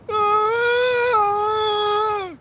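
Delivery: long high scream while flying; brother's high scream in midair; high yell. Retold or imitated. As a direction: let out a long high wailing ah. Brother flying through the air.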